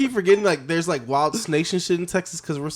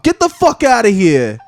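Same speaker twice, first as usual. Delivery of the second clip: loud, high, hammed-up Boston accent; Boston pigeon; 7 semitones higher, 10 dB louder, slower than usual. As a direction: holler like a brash Boston bird. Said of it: "Like a Boston pigeon just says".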